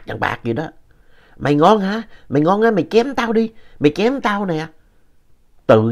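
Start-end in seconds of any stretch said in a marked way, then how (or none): 1.4-4.7 high, shrill-pitched taunting dare; sharp-tongued yelling. A narrator's reading. The mistress screams a dare at her man, high and taunting. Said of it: "bà ghệ của ông cũng la lớn lắm".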